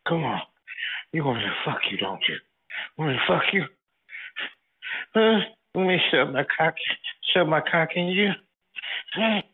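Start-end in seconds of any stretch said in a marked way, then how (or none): none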